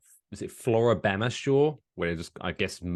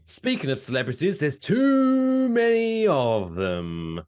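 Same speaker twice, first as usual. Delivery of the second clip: high, drawn-out slow announcer; 8 semitones higher, 5 dB louder, slower than usual. Acted. Host introducing the trap door segment, slow and high. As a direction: build it up like a show segment. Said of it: "segment intro announcer".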